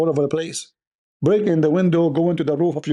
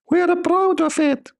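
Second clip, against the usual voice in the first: much higher, slow mock falsetto. Mocking Muslims' pride in a weak prophet, falsetto. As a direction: mimic a squeaky weak man. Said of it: "voice of a man".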